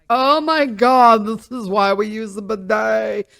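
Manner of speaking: lightly voiced smug European; mock European friend. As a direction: play an amused European scoffing at Americans. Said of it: "all my European and whatnot over there are go like".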